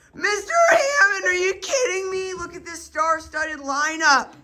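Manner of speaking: high, loud mock-announcer cheer; over-the-top fan excitement; hyped-up introduction. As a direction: shout it like unveiling a celebrity. Hyped introduction of guest teachers.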